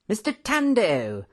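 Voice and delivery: high-pitched, delighted greeting; excited cry. A narrator's reading. Professor finding Mister Tando, excited cry. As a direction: call out the name in high delight. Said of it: "he cried excitedly".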